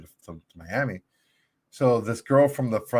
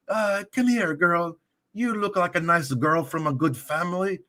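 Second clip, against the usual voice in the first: lightly imitated Russian cab driver, higher; a hint of a Russian accent telling a tale, higher; 6 semitones higher than usual. Cab driver telling his funny story in a light Russian accent. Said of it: "with his little accent".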